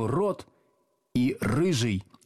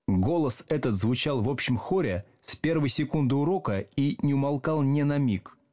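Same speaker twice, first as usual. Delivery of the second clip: plain reading voice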